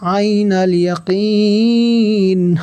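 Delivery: slow, high melodic tajweed chant; slow sung Quran recitation; Quranic recitation. Shown as a demonstration. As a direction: draw out each word in recitation.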